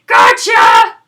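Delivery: deep booming dinosaur shout; dinosaur yell. A narrator's reading. Dinosaur yells from the forest, deep booming shout. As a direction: roar it like a huge beast.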